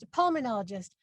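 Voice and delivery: ordinary talk, nothing marked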